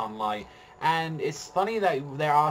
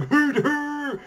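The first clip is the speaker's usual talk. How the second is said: high, loud drawn-out hoot; imitated hooting; 8 dB louder than usual. Mimicking Aaron rushing off, high hooting. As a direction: hoot out high like a charging cry. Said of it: "Aaron just going".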